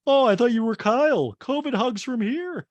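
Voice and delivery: high, loud gushing greeting; mock Kathy. Kathy greets Garcelle, high and hammed-up.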